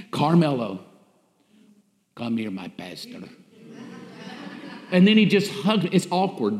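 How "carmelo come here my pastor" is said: deep, soft, tender call; low gentle voice of a loving churchgoer; congregant's greeting. Retold or imitated. Church member greeting his pastor, deep and tender. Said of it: "every single week".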